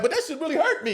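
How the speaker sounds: high, playful hurt protest; mock wounded. Teller to laughing listeners, high mock hurt.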